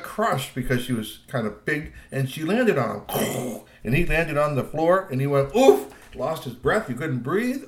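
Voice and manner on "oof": sharp, high, loud oof; winded oof. A narrator's reading. Landing hard on the floor, winded oof. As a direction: knock the wind out of yourself with an oof. Winded sound of the fallen rescuer.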